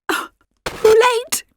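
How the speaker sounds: hoarse, high and slow despairing groan. A narrator's reading. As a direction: croak it out hoarsely, slowly. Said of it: "said Clyde hoarsely".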